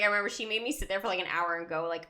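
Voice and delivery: ordinary delivery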